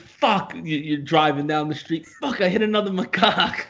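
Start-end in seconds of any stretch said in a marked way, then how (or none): 0.0-0.5 startled curse. Pretend. Driver hitting a macaque. 2.1-3.7 startled curse. Pretend. Driver hitting a macaque.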